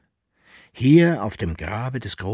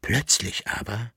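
ordinary delivery

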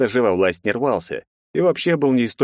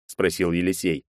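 usual speaking voice

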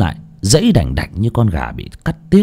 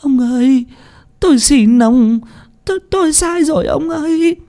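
very high, weeping plea; tearful pleading. A narrator's reading. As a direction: beg through the tears. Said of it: "nước mắt nước mũi giàn giụa". (giọng khóc lóc, van xin)

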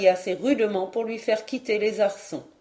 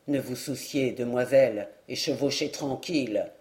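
much lower, softer dwarf voice; a small knight keeping her calm, low; 8 semitones lower, 4 dB quieter than usual. Acted. Dwarf soothing the maiden, low and soft.

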